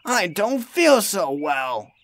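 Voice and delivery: slow, high, weary sick engine; feeling unwell. Acted. James stranded and unwell, slow and feeble. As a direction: drag the words out feebly.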